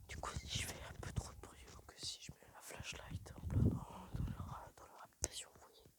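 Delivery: hushed whisper so no one wakes up; real whisper. Real, not acted. Creeping past houses where people sleep, whispering. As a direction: barely breathe the words, whispering. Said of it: "on va rester silencieux".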